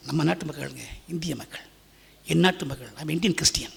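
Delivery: ordinary delivery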